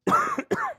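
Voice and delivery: harsh dry cough; coughing fit. Real, not acted. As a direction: cough hard, caught off guard. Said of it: "Excuse me".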